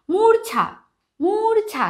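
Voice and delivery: মূর্ছা said high with a clear r before ছা; emphatic teaching demo. Shown as a demonstration. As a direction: model মূর্ছা with a distinct r. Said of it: "উচ্চারণটা হবে মূর্ছা".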